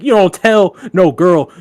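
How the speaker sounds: indignant retold roast; friend losing it. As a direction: imitate an outraged friend laying down the law.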